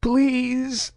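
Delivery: high, slow sobbing please. A narrator's reading. Batarian sobs after screaming, begging Saren. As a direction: sob it out. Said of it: "the Batarian sobbed".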